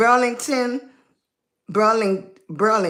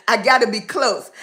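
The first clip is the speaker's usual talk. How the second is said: usual speaking voice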